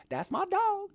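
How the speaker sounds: high squeaky imitation of Smoke; Smoke quote. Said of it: "And, and Smoke goes".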